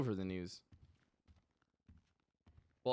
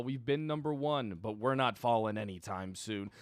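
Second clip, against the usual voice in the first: ordinary delivery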